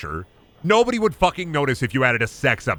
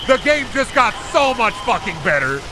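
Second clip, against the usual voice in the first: gleeful; 10 semitones higher, 3 dB louder than usual. Real, not acted. Gleeful reaction to achievement.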